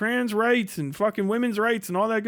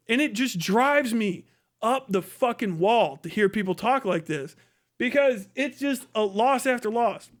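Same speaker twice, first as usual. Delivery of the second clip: usual speaking voice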